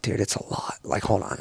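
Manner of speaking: very soft, low retold murmur; barely voiced, confidential reply; hushed aside. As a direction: keep it down between buddies. Hushed aside to dive buddy.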